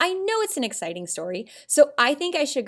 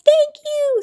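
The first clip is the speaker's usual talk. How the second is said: much higher, louder thrilled thank-you; so excited; 10 dB louder than usual. Shown as a demonstration. Birthday child thanks the party guests, very excited. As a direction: burst out with joy. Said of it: "so excited".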